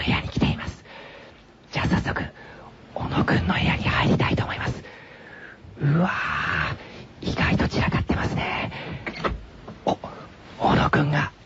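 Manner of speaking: hushed whisper, sneaking in. Real, not acted. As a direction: whisper so he stays asleep. Sneaking into sleeping room.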